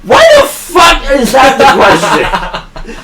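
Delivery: high, slow, laughing outburst; laughing disbelief. Real, not acted. Answering cohost's weird question, real laughing disbelief. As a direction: laugh out the protest.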